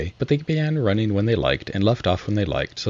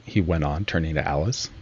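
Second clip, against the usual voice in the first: plain reading voice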